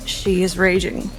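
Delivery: ordinary talk, nothing marked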